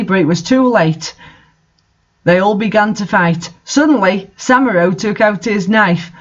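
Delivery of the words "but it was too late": ordinary delivery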